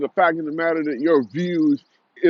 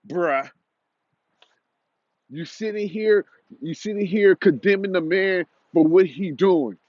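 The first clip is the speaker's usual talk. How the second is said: ordinary talk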